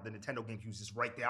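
usual speaking voice

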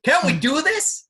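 mock high shout. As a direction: squeak it out in mockery. Mocking Trump asking scientist.

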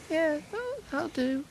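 put-on old lady voice. Old patient agrees meekly.

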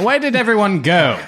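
high, plaintive doddering gentleman; bewildered Van Richten. Acted. Man left on the stairs calls after party, bewildered. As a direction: call out, lost and befuddled.

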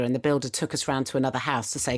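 ordinary delivery

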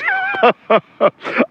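helpless high-pitched laughter; high squealing laugh. Real, not acted. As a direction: squeal with laughter, can't stop. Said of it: "I didn't stop laughing".

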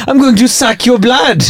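high, slow mock Welsh; be a Welsh leech, drawing it out; Welsh accent. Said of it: "so funny in a Welsh accent".

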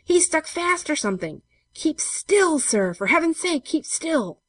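high, loud exasperated plea; strained, high-pitched urgent yell; urgent shouting. A narrator's reading. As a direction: shout down the well to him. Men hauling a struggling weight.

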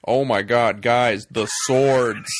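loud, slow put-on American drawl; mock American accent. Mocking Craig's unconvincing American voice, loud and slow. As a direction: ham up a bad Yank accent. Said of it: "American accent that's so unconvincing".

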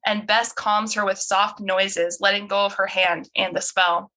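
normal talking voice

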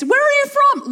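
very high, sing-song church lady voice; nosy older sister. Retold or imitated. Older sister quizzing a visitor at the door, high and sweet. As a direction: chirp it out like a sweet busybody.

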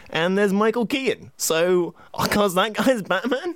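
high-pitched baffled disbelief; acted bewilderment, rising high; mock incredulity. Acts Burton's baffling casting choice, incredulous.

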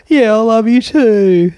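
high, loud, slow lovestruck voice; mock lovesick reply. Mocking a lonely viewer answering the video, lovestruck. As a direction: swoon it like a lonely fan.

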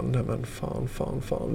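unvoiced whispered swearing to himself; whispered curse. Retold inner thought after crash. (viskad svordom)

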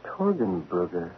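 low, slow, stunned under the breath; breathless shock at a name; stunned mutter. Acted. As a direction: say the name as it dawns on him.

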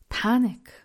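a sudden burst of retold alarm on Panic; mock panic.

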